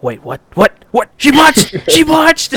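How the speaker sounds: rising to a very high, loud cry of "She botched!"; from a puzzled "wait, what?" up to a high, loud shriek; shocked disbelief. Retold or imitated. Host reenacting his reaction to the botched promo, disbelieving squeal. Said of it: "I was like".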